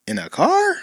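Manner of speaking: high, puzzled mock reply; puzzled literal answer. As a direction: answer as a baffled literal-minded person. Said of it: "the person who's gonna be like".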